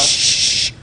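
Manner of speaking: long, voiceless hushing sound; a firm shush to cut off talk. Real, not acted. Judge stops witness talking to the other party, shushing.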